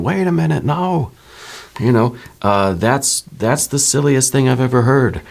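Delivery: high, incredulous scoff; skeptical common sense. Acted. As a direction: jump way up in pitch, dismissing it outright. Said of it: "your common sense is saying".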